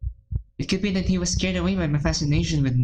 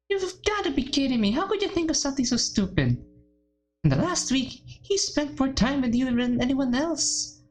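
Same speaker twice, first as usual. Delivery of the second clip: incredulous Monica; 9 semitones higher than usual. Acted. Monica scolding Yuri.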